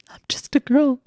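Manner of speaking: breathy little whisper, playing helpless; hushed mock-innocent whisper. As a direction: whisper it like a delicate girl. Mocking the quote in a whisper.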